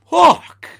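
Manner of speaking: very high, very loud reenacted yelp; pained yell. Customer having nose hair ripped out, mock pained yell. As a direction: yell as if wincing in sudden pain.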